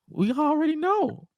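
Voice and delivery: very high-pitched mock exasperation; high, put-on voice of an exasperated complainer; Paul Heyman. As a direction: squeal it as a hammed-up imitation. Acting out Paul Heyman's exasperated reply.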